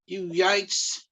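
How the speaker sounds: high-pitched, hammed-up British accent; outraged British fan, accent badly faked; terrible British accent. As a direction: do a bad British accent, jeering and indignant. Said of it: "sorry about my terrible British accent".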